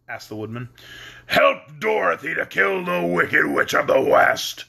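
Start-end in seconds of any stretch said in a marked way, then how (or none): 1.3-4.6 slow, lightly voiced monster; a hint of the beast voice. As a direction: command it like a throne beast. Beast gives the Woodman a task.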